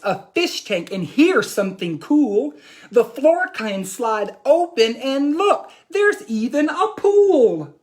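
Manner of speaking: put-on lively read-aloud as young Jack; animated picture-book reading; reading to children. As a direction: tell the boy's boast to the class.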